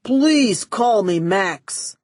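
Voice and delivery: very slow, loud, crooning creep; lovesick. Acted. Max wooing Melody, very slow loud crooning. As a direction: ooze it at her slowly.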